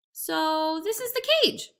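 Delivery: slow, musing jackal voice; sly jackal. A narrator's reading. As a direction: jackal feigning thought. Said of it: "said the jackal".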